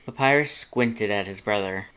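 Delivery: plain reading voice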